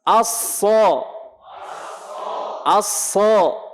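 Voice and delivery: thick, open "Aso", pausing between repeats; thick emphatic sad. Shown as a demonstration. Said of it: "Tebal suaranya".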